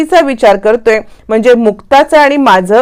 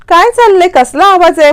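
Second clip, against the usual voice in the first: alarmed; 9 semitones higher than usual. A narrator's reading. Commotion heard outside, alarmed.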